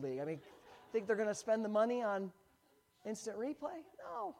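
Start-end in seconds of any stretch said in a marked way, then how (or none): 4.0-4.3 high, sarcastic no; scoffing no. Real, not acted. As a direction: answer your own question with a scoff. Rhetorical dismissal.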